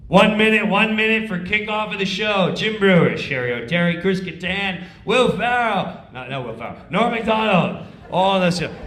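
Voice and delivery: loud cue call; stage manager calling. Retold or imitated. Stage manager calling cast to the floor, loud.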